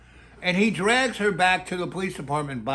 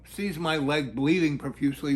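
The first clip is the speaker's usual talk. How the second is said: usual speaking voice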